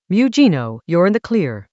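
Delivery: ordinary delivery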